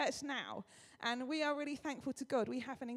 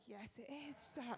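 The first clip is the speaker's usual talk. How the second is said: soft, held-in agreement; straight-faced; 2 semitones lower, 9 dB quieter, slower than usual. Retold or imitated. Humouring an excited friend while hiding her secret, straight-faced. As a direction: agree quietly, giving nothing away. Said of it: "I managed to keep a straight face".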